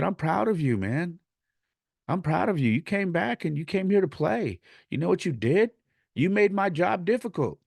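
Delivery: high, fired-up proud coach; impassioned, high-pitched encouragement; emphatic praise. Retold or imitated. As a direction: praise the player with pitch pushed well up. Retold coach praising his player.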